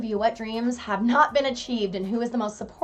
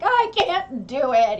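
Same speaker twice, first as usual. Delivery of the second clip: mock despair; 5 semitones higher, 5 dB louder, slower than usual. Quoting her own despair.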